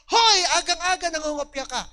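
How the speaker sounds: sudden loud yell at a copying student; teacher's shout. Retold or imitated. Professor catching a student copying, loud shout. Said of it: "sisigaw na lang ako".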